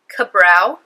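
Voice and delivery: ordinary talk, nothing marked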